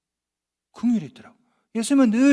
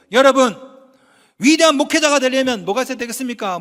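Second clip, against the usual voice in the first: loud, raised rhetorical question; loud preaching challenge; 8 dB louder than usual. Retold or imitated. Preacher re-enacting his sermon to young seminarians, loudly challenging them. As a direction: call out to the whole chapel.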